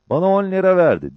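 high, loud boast; animated high-voiced storyteller; Topal Ali. A narrator's reading. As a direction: pitch it right up, spinning a tale. Topal Ali retelling his lie gleefully.

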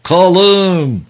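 very high, loud, long-held shout; joyful roar. A narrator's reading. Father waving to his son's boat, joyful bellow. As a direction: holler the name across a great distance. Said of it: "diye sevinçle gürledi".

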